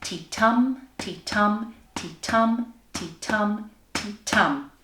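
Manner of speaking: "ti-tum" chanted in steady weak-strong beats; heartbeat rhythm. Shown as a demonstration. Teacher leading everyone all together, rhythmic chant. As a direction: lead the group through five "ti-tum" pulses. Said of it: "All together".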